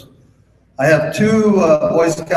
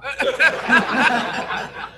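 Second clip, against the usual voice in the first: laughing at own punchline; 11 semitones higher, 3 dB quieter than usual. Real, not acted.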